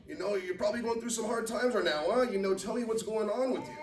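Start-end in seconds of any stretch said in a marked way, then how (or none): none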